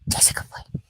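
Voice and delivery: tender whisper to a dog. Real, not acted. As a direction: hush it to the puppy.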